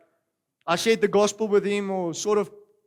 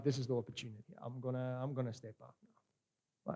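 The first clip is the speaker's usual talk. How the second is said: quiet inner resolve; 6 semitones lower, 16 dB quieter than usual. Retold or imitated. Inner resolve at grandmother's deathbed.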